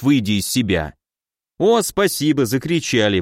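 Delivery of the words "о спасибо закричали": usual speaking voice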